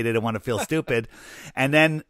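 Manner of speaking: usual speaking voice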